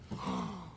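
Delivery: sharp surprised gasp in. Shown as a demonstration. Showing a child a surprised face, sharp gasp. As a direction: gasp as if startled. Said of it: "wanna see how I look when I'm surprised".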